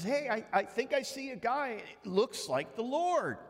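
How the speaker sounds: high excited spotting; excited call. Acted. Disciple in the boat excitedly points out the Lord.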